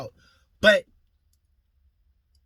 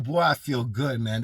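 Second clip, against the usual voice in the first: ordinary talk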